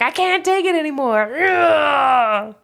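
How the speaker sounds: high, strained mock breakdown; hammed-up exasperation. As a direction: whine it like you're at the end of your rope. Shrek losing it, voiced.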